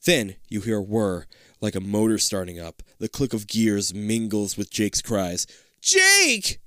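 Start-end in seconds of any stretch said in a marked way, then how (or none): 5.8-6.6 high, loud shout of a name. Acted. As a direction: shout after a friend. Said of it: "you shout".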